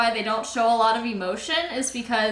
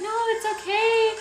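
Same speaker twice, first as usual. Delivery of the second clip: calm friend; 10 semitones higher than usual. Retold or imitated. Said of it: "And she's like".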